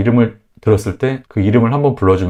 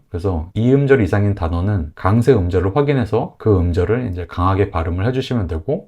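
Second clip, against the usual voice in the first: ordinary talk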